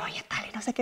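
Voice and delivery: softly whispered chatter; movie whisper. Retold or imitated. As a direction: whisper as if in a cinema.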